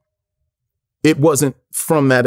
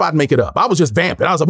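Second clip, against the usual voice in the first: ordinary delivery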